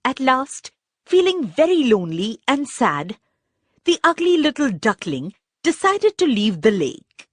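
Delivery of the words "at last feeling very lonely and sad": ordinary delivery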